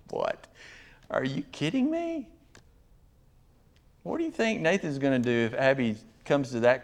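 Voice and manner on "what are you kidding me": high, softer disbelieving question; incredulous. Acted. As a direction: ask it high in mock disbelief. Incredulous retort to quitters.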